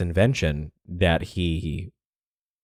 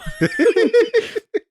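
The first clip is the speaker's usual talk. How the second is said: high shrieking laugh; 4 dB louder than usual. Real, not acted. Big laugh at name.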